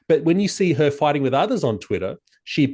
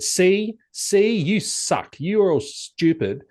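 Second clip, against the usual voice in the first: put-on high, slow childish jeer; mock gloating taunt; 7 semitones higher, much slower than usual. Imagining gloating back at critics, childish taunt. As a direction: sneer like a sore winner. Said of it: "if I then turn around and said".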